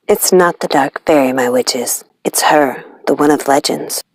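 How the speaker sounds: the cat warning her witches in a low hush; knowing cat. Acted. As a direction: let the cat confide a grave secret quietly.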